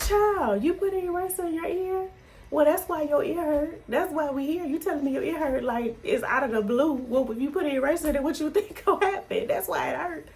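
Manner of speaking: mom can't believe what he did; exasperated mom scolding. Retold or imitated. Mother scolding son over eraser.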